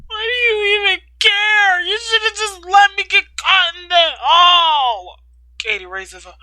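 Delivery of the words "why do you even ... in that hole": high, loud tearful shout; crying shout. Acted. Katie to Ryder, crying and shouting. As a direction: yell it through tears.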